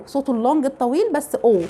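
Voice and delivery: usual speaking voice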